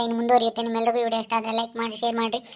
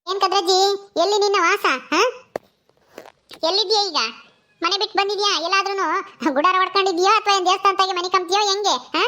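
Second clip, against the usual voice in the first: higher-voiced village woman, prodding with questions; questioning Gangi; 8 semitones higher than usual. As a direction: quiz the old woman in a lifted voice. (ಪ್ರಶ್ನಿಸುವ ಗಂಗಿಯ ದನಿ)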